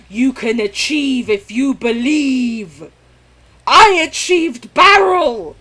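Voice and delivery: hammed-up motivational cheer; mock triumph.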